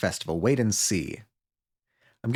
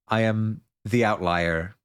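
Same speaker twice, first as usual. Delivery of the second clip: usual speaking voice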